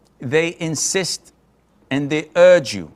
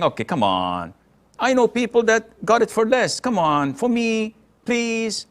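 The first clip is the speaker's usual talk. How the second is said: coaxing customer, lines rising higher towards the end; flirty bargaining; 7 semitones higher than usual. Retold or imitated. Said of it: "you don't bargain with saying".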